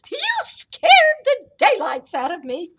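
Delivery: put-on high, loud old lady voice; hammed-up high-pitched fright; startled old woman's cry. A narrator's reading. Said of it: "cried the tiny old woman".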